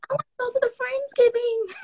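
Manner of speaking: put-on high, pleading childlike whine; whiny little kid. Stuck in hospital, begging to join Friendsgiving, high kid whine. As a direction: beg like a small child.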